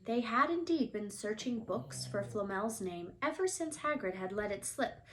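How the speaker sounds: ordinary delivery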